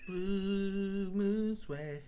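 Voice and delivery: very slow, spelled-out "Blue moose way"; slow French. Shown as a demonstration. Host sounding out the French phrase, drawn-out syllables. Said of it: "it means until we meet again".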